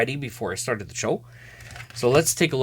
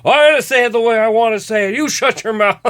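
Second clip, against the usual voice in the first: higher, louder, fast defiant pundit retort; Don Cherry comeback; 8 semitones higher, 5 dB louder, faster than usual. Retold or imitated. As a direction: fire it back like a stubborn commentator. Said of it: "He goes".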